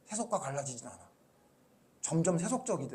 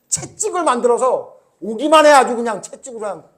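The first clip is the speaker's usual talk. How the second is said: loud, high, threatening outburst; angry threat; 6 semitones higher, 13 dB louder than usual. Retold or imitated. Pastor acting Jesus with the whip, angry threat. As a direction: warn them off with raised voice. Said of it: "이랬던 예수님이에요".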